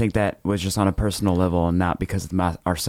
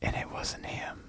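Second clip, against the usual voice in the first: whisper; 14 dB quieter, slower than usual. Acted. Deadpan story reveal.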